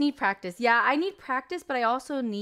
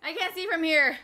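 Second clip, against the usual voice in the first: loud complaint; 5 semitones higher, 7 dB louder than usual. Real, not acted. Can't see the screen, exclaiming.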